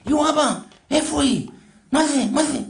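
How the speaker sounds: high, excited questions; acted amazement at a magic trick; astonished boy. Boy astonished the old woman vanished. (ילד נדהם, בקול גבוה)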